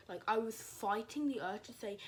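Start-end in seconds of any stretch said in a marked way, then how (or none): none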